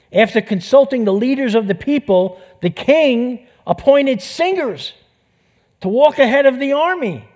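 preacher marvelling at the game plan; excited preaching. Real, not acted. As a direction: build it up with wonder.

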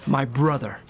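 ordinary delivery

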